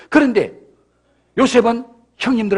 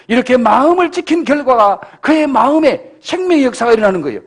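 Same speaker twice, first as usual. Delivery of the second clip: loud, rising sermon climax; preaching at full force; 4 dB louder than usual. Acted. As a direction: drive the point home to the congregation. (목청 높여 외치는 설교)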